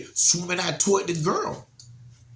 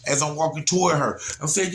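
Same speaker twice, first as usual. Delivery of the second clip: ordinary delivery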